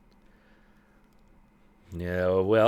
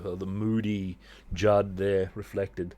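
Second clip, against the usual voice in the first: usual speaking voice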